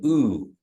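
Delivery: long rounded ooh; teacher models the U sound; Kiowa ooh. Shown as a demonstration. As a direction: say ooh with lips pushed forward. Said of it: "the U sound is a little bit different".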